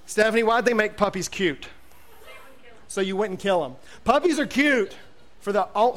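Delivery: usual speaking voice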